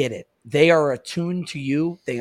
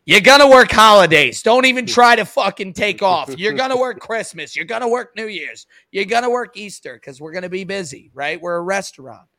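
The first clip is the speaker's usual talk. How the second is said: higher, loud mock boss laying down the law; bossy manager; 4 semitones higher, 7 dB louder than usual. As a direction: play the manager barking holiday orders. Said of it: "the manager or the boss has been like".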